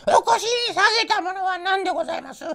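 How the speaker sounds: a pheasant calling to Momotaro; high birdlike voice. A narrator's reading. Pheasant asks.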